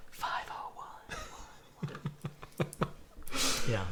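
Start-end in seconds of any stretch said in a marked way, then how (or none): 0.1-1.2 whispered slogan. Pretend.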